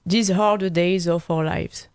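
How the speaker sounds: song title said in an English accent by a French speaker; a French host trying his English accent on a Queen title; French host apologizing, then saying the Queen title in an English accent. Shown as a demonstration. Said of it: "excusez-moi pour mon accent anglais".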